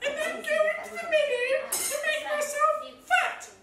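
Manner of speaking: tiny high squeaky goat voice; very small voice. A narrator's reading. Youngest goat answers the troll, in a very small voice. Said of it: "in his very small voice".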